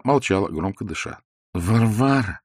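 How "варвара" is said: drawn-out nasal call; nasal Lokhankin. A narrator's reading. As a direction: say it through the nose. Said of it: "повторил он". (в нос, протяжно)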